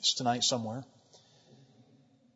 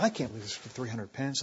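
low, hushed grumble; background whispering; 6 semitones lower, 11 dB quieter than usual. Acted. Preacher as Judas stirring up disciples from the background, hushed and low. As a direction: mutter it under your breath to those nearby. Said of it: "here's Judas in the background".

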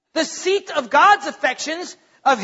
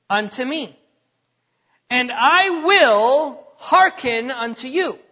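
slow, drawn-out phrases with long pauses; solemn scripture reading; much slower than usual. Acted. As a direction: let each word of the verse land. Reads scripture slowly.

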